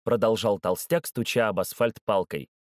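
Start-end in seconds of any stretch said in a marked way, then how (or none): none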